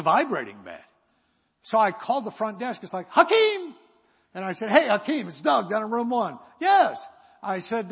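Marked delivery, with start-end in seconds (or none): 3.1-3.7 high, loud call of a name. Retold or imitated. Calling out to the clerk. 4.7-6.4 loud, higher phone greeting; calling on the phone. Retold or imitated. Phoning the front desk. 6.6-7.0 high, loud curt clerk reply; clerk answering. Retold or imitated. Hakeem answers the phone.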